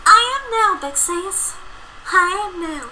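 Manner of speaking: high relieved little filly. Acted. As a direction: answer with happy relief.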